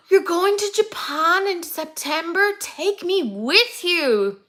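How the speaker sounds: gushing take me with you; excited plea. Acted. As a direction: stretch it out like a longing fan. Excited reaction to viewer's trip.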